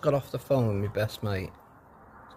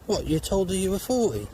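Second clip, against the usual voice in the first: mock surprised mate; 9 semitones higher than usual. Mate voiced in a joke.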